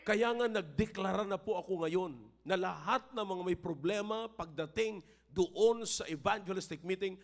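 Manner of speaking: high, loud preaching that swells to a shout; preaching at full force. Acted. Pastor to the congregation about money, preaching at full force. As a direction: drive it home like a fired-up pastor.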